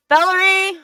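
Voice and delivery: loud high call across the room. Acted. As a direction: shout her name to get attention. Calling out to companion.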